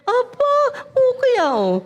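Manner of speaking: high, impressed exclamation; mock impressed churchgoer, high pitched; impressed surprise. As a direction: act wowed by the cook. Surprised reaction to the cook.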